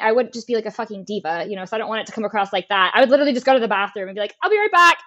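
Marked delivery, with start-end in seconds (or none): 4.4-5.0 high-pitched, put-on breezy call; put-on breezy excuse. Driver excusing herself to hide in the bathroom, put-on breezy.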